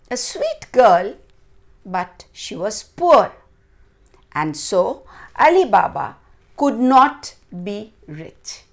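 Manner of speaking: ordinary delivery, nothing marked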